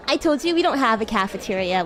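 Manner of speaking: ordinary talk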